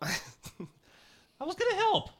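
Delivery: very high, slow little voice; small sheepish squeak. Acted. Late helper repeating the excuse, small high squeak. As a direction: squeak it out slowly, without force.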